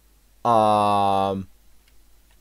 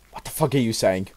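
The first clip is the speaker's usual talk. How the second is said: ordinary talk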